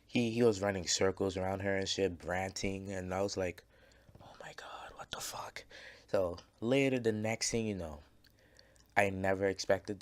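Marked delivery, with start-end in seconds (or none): 4.2-5.6 breathless whisper of disbelief; shocked whisper. Retold or imitated. A bystander whispering in shock as his friend runs at a girl. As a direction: whisper it, stunned, so nobody hears.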